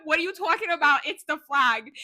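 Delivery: put-on Boston accent. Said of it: "I don't know where it came from".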